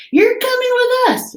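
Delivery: pirate captain. Acted. Braidbeard tells the boy he joins the crew, high hearty order. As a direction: tell the boy he's coming, jolly and bossy. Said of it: "Braidbeard told me".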